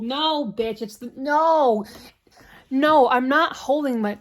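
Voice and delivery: loud, drawn-out mock-outraged "no"; loud refusal. Voicing Annie refusing Igor's scarf, loud acted protest. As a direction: refuse it at full volume, hammed up.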